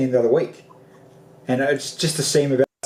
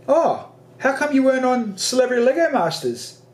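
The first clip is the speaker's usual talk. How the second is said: 1 mock taunting brother; 10 semitones higher than usual.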